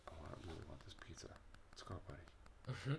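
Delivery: fast, hushed whisper to himself. Real, not acted. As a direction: whisper the craving under the breath. Whispered aside about pizza.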